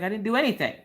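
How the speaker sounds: retold defensive denial; mock protest of innocence. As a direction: plead innocence, lightly hammed up.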